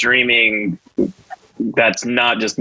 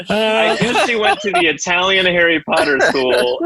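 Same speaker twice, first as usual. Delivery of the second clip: laughing; 6 semitones higher than usual. Retold or imitated. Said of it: "John said something like".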